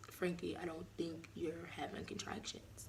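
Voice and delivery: hushed, breathy imitation of the partner; mock whisper. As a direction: whisper it, dismissive and calm. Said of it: "Just like, "All right".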